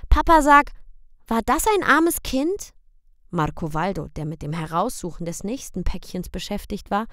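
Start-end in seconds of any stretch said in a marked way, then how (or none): 0.1-2.7 put-on high, loud little boy's question; high child's voice; child asking. A narrator's reading. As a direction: ask papa like a worried small boy. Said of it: "Draußen fragte Michel".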